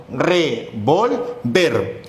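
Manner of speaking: slow "revolver" in separate syllables, landing on VER; stress on final syllable. Shown as a demonstration. Teacher pronouncing the word for the class, revolver with stress on VER. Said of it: "el acento está en ver".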